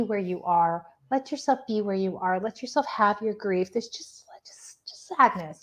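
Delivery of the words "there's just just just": soft slow whisper, barely any voice. Real, not acted. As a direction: breathe the words out slowly in a whisper. Sensing sadness during a reading.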